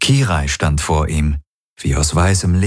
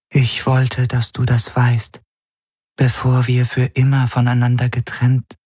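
a servant spirit bidding her master farewell; 6 semitones higher than usual. A narrator's reading.

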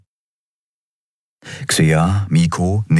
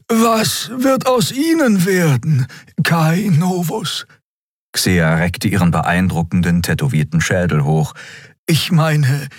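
high-pitched, deliberate inquiry; Xia; 11 semitones higher than usual. Acted. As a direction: question formally in a high, stretched voice.

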